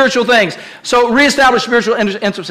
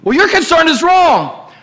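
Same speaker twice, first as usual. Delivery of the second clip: mock dismissive retort; 8 semitones higher than usual. Spouse dismissing a concern in an argument, mock angry. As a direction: shoot the concern down, defensive. Acted quarrel between spouses.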